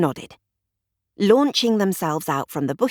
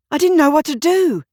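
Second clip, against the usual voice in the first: breathless and loud, higher pitch; panting; 9 semitones higher, 6 dB louder than usual. A narrator's reading. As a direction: blurt it out short of breath. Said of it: "he panted".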